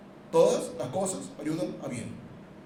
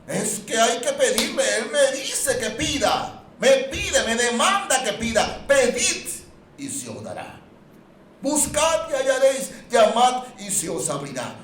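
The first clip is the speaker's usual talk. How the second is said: louder, higher fiery preaching; full-force preaching; 10 semitones higher, 8 dB louder than usual. Acted. Preacher urging the congregation, loud and high. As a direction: thunder the promise to the congregation.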